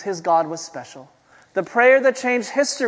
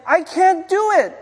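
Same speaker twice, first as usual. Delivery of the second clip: put-on high, drawn-out kid's whine; whiny little kid; 10 semitones higher, 5 dB louder than usual. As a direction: play a small child who gives up. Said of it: "the little kid's like".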